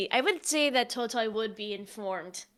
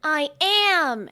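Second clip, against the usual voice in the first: put-on proud child character; 5 semitones higher, 6 dB louder than usual. Totoi proudly agrees.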